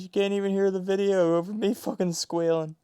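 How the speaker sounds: usual speaking voice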